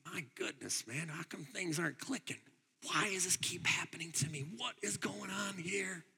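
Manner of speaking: hushed, nearly whispered frustration; whispered lament. Retold or imitated. A weary believer whispering his frustration to himself. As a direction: breathe it out like a private complaint.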